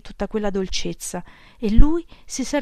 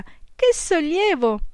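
joyful relief; 9 semitones higher, much slower than usual. A narrator's reading. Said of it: "gridò lei con gioia".

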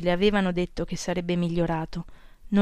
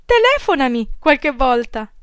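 higher and louder, breezy goodbye; young girl's voice; 10 semitones higher, 6 dB louder than usual. A narrator's reading. Girl cutting the phone call short, higher and louder, breezy. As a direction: hurry him off the line sweetly.

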